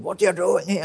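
high mock yell, caught off guard; a chef bursting out at a hidden boy, half-acted; retold scream. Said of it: "and screamed at me".

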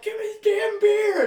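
high, loud mock yell; imitated yelling dad. Host imitating the girl's dad in the movie, yelling. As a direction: holler it like an angry movie dad. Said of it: "when her dad's yelling about".

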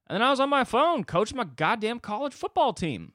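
high, loud burst of exasperation; comic outburst. Acted. Comic outburst about his own phone.

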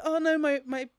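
parodying the heroine's tearful news; mock dramatic girl. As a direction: ham up the heroine's distress.

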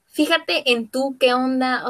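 normal talking voice

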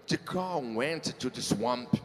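slow, drawn-out careful English; slow literal English. Shown as a demonstration. Host slowly reads a literal English idiom to [name]. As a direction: read it slowly, word by word. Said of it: "A minha pronúncia é ruim mesmo".

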